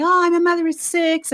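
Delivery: high-pitched, self-mocking brag; cocky boast. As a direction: brag like you know it all. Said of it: "I'm, like, cocky as all get".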